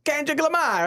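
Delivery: high-pitched, exaggerated Caribbean accent; Trinidadian-sounding accent. Host redoing the fan's cry, exaggerated Trinidadian-sounding accent. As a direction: imitate the fan with a Caribbean lilt. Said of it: "I sound like from Trinidad and Tobago".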